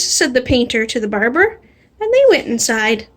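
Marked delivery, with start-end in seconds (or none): none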